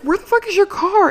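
high, loud incredulous outburst; exasperated. Retold or imitated. Asking the driver where his car is, loud disbelief. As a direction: yell it in disbelief.

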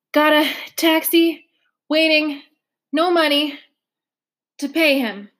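panting out each bit of the sentence; puffing, out of breath. Acted. Trotter puffing out of breath at the counter. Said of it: "Trotter was puffing worse".

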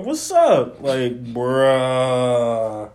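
ordinary talk, nothing marked

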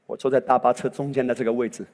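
usual speaking voice

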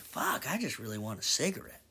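soft, worn-out longing; mock craving sigh. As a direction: murmur it like you're dying for a smoke. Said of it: "it's like".

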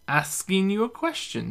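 high, stumbling tipsy reply; stuttering drunk. A narrator's reading. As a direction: trip over the words a little, tipsy. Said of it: "she stutters".

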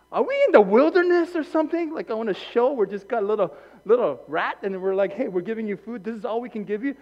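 loud, high, angry preaching; fiery sermon climax; preaching at full force. Real, not acted. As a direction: shout it to the church.

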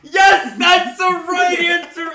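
very loud, high, triumphant yelling; thrilled shout. Real, not acted. Host hearing a guest pick his title, shouting in delight. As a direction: shout with joy at the win. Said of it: "no one says The Cat's Pajamas".

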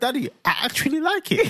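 put-on high little-boy voice; imitating pleased son. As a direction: mimic a child reassuring his dad. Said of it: "and he went".

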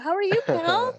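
high, loud squeal; high squealy greeting. Acted. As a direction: squeal it at your brother.

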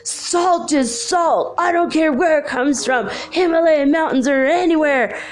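high-pitched imitation of a man losing it; freaking out. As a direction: imitate an agitated, dismissive outburst. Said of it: "he's, like, freaking out about salt".

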